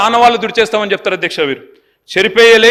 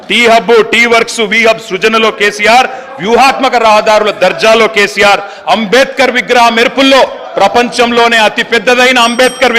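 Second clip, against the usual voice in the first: declaimed political litany with a repeated refrain; rhetorical roll call; 2 semitones higher, 5 dB louder, slower than usual. Real, not acted. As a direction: build a rhythmic list, landing each refrain firmly. Chanting roll-call in assembly speech.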